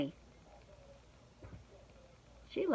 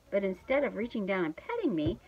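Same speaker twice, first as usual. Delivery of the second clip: plain reading voice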